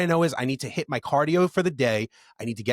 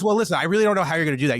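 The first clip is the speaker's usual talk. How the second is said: rushed doctor; 4 semitones higher, 5 dB louder, much faster than usual. Retold or imitated. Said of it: "He goes".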